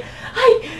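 high, laughing girly mock voice; mocking woman. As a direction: parody a coy woman playing hard to get. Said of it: "andarse de que".

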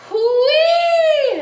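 high, long, ringing squeal; loud exclamation. A narrator's reading. Lily jumps back from the coyote, exclaiming shrilly. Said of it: "exclaimed Lily".

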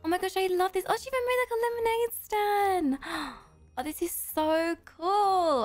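high gasping wonder, words stretched; amazed. Real, not acted. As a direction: gush in awe at what you see. Amazed by a fan's town.

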